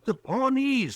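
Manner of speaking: anxious, pitched high with news of the attack; urgent, frightened warning; nervous shopkeeper spreading alarm. Acted. Green names the attackers.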